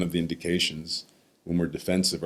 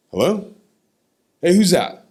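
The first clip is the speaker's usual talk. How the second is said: very high, loud imitated grandfather; retold phone shout; 11 semitones higher, 9 dB louder than usual. Grandfather answering the phone years later, loud imitation. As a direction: answer loudly, pitched way up.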